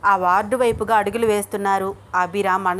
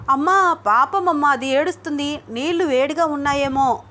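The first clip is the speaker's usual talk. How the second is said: anxious pleading; 5 semitones higher than usual. A narrator's reading. Aunt begging grandma to stop the hot bath, anxious. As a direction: fret over the crying baby, voice up and urgent. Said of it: "కంగారు పడుతూ".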